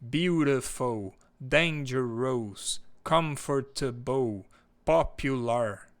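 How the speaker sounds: each word drawn out slowly for the class; slow, careful classroom delivery; slow model words. Shown as a demonstration. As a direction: spell them out slowly like a teacher. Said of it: "por exemplo".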